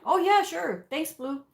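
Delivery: eager provider. Retold or imitated. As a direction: play the provider happily grabbing a snack. Provider offered a snack.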